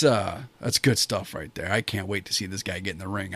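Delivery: ordinary talk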